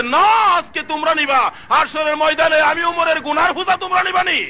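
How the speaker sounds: high, shouted acted protest; impassioned shout as the caliph; vehement refusal. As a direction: refuse at the top of your pitch. Umar refuses the guard, acted.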